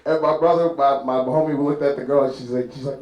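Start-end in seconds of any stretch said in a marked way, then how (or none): none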